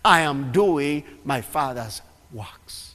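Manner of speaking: slow, deliberate solemn words; slow solemn delivery. Acted. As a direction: let each word land slowly. Preacher slowing Jesus' words.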